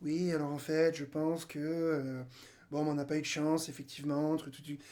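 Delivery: low, soft grumbling excuses; imitating a sulking Lyon supporter, quietly; mock sulky fan. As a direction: mumble like a beaten fan making excuses. Mimicking a Lyon fan's excuses.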